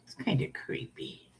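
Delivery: hushed aside, barely voiced; soft creepy whisper. Real, not acted. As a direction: whisper it like a secret. Aside after the story ends.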